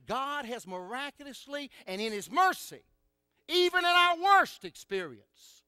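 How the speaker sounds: building preaching. Real, not acted. Preacher on suffering and God's mercy, building preaching. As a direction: build steadily to a high, loud peak.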